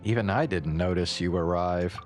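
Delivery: usual speaking voice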